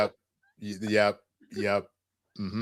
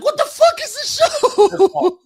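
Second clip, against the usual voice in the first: high, slow, laughing disbelief; shocked disbelief; 5 dB louder, much slower than usual. Retold or imitated.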